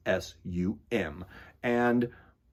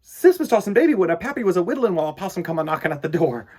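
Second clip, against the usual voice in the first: put-on fast, louder storytelling to children; lively read-aloud; 8 semitones higher, 7 dB louder, faster than usual. Reading a rhyming book to kids, fast and lively. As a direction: rattle off the cumulative list, quick.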